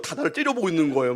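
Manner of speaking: usual speaking voice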